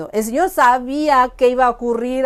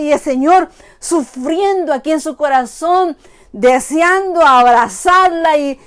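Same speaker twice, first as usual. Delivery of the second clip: ordinary delivery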